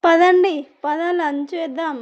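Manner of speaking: loud, bustling call to come along; rally everyone with a loud first word; hurried urging. A narrator's reading. Shishira hustling the family to lunch, hurried urging. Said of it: "హడావిడి చేసుకుంటూ".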